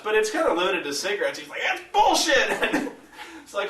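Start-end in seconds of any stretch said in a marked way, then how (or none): 1.6-2.9 high, slow mock outrage; drawn-out protest. Friend objects to the tax explanation, high drawn-out outrage. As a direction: imitate a wailed objection, stretched out high.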